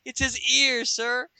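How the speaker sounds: high squeaky henchman voice; lightly voiced minion. Henchman tells his boss about Nort's ears, lightly squeaky. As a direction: pipe up like an eager lackey.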